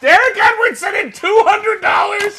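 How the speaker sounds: loud, high hammed-up scolding; mock outrage shout. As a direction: yell at him in joke outrage.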